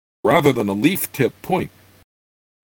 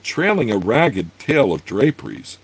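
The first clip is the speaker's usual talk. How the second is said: usual speaking voice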